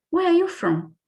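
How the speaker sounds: high, bright mock-friendly question; curious listener. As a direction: imitate a listener charmed by her accent. Listeners ask about her accent.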